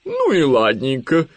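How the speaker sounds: high, slow, loud owl voice; sudden mood change. A narrator's reading. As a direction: switch to breezy acceptance, drawing it out. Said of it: "внезапно сменила настроение сова". (сова внезапно сменила настроение, протяжно)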